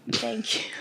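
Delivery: a breathy whispered thank you. Real, not acted. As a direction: whisper the thanks to yourself, touched. Thanks after praise, repeated.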